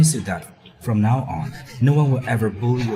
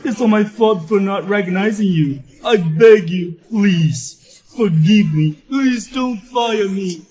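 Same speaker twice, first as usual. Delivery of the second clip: desperate begging; 5 semitones higher, 4 dB louder than usual. Acted. Worker begs the boss.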